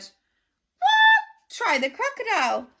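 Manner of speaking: very high, loud squawk; squawking bird. Acted. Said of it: "squawked another".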